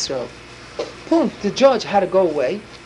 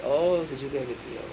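knowing gotcha; 7 semitones lower, 8 dB quieter than usual. Retold or imitated. Judge catches the liar.

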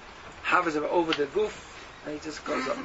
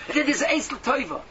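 rising exhortation; 7 semitones higher than usual. Real, not acted.

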